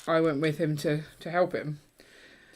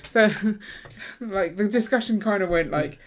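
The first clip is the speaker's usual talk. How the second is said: ordinary talk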